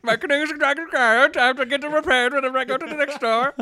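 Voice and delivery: high, loud, garbled goofball; canoe-dragging adventurer voice. Acted. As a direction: rattle it out as the absurd adventurer, high-pitched. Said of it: "He's like".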